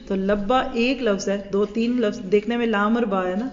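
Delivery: ordinary talk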